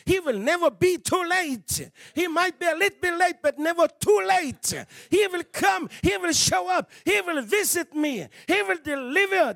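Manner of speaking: loud, high preaching at full force; fervent sermon shout building to a peak. Real, not acted. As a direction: preach it out with all you have. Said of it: "I'm shouting tonight".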